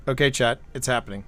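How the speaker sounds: ordinary delivery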